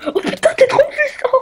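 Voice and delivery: voix aiguë qui éclate de rire; laughing. Real, not acted. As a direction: rire en parlant, très haut. Rit pendant le combat. (parole noyée de rires)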